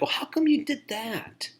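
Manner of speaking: hammed-up high-voiced grilling; mock interrogating. Poor listener interrogating a person, mock incredulous questioning. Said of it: "not interrogating a person".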